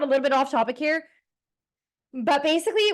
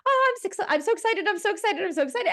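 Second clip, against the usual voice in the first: high, gushing excited squeal; pitched-up delighted chant; mock excitement; 8 semitones higher, faster than usual. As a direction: bounce it up like a giddy fan. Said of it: "if you call it excitement".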